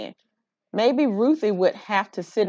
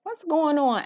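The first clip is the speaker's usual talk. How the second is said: higher, concerned teacher voice; teacher's question; 8 semitones higher than usual. Acted. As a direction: step in as the teacher. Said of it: "asked Missus Olson".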